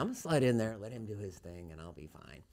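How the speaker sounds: fading to a low, very soft murmur; cautious self-talk. Retold or imitated. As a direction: talk yourself through it under your breath. Cautious self-talk near crazy guy.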